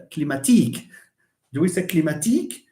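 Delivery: climatique said the French way, last syllable stressed; French-style wrong form. Shown as a demonstration. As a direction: show the French-sounding mistake. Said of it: "it's not".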